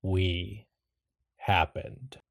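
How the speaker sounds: lightly voiced ominous presence; measured pronouncement with a pause between words; deep rumbling voice. Mysterious voice answers Iris, lightly deep and rumbling. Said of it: "And the voice says".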